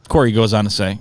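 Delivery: ordinary delivery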